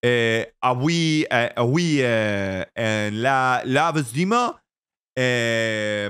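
usual speaking voice

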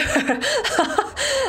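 high burst of laughter. Real, not acted. Laughs at own reluctance.

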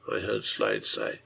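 ordinary delivery, nothing marked

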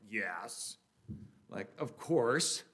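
slow, drawn-out, put-upon reply; mildly irritated. Retold or imitated. McDonald's cashier asked if she speaks English, mildly irritated. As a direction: imitate an eye-rolling, obvious yes. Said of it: "Little irritated".

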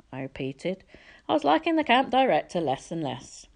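plain reading voice

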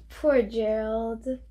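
plain reading voice

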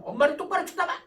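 mock-stern scolding, pitched high and loud; scolding mother. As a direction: act out a mother telling off her child. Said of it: "엄마가 자녀를 혼내킬 때 이렇게 혼내킵니다".

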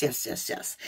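unvoiced whispered yes yes yes; hushed whisper. Reader voicing a fire sign who keeps joy secret, whispered. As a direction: breathe it like a secret.

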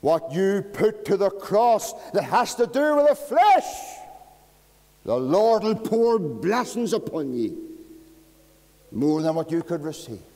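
loud, high, ringing preacher's cry; full-force preaching. Acted. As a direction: hammer each question at the congregation.

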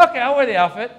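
high, loud, rising yes; over-eager agreement. Acted. Comic agreeing to owner's demand, loudly eager. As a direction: agree too readily, pitched right up.

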